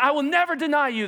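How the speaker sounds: high, loud, insistent promise; impulsive vow. Acted. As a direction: swear it with sudden all-in fervour. Acted Peter's impulsive vow.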